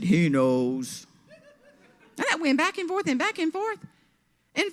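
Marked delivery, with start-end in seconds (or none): 0.0-1.1 mock ominous woman. Woman's voice in retold quarrel.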